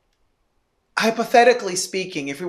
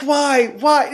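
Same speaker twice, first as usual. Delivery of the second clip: higher, louder disbelieving why; incredulous why; 6 semitones higher, 3 dB louder than usual. Retold or imitated. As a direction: plead in mock disbelief.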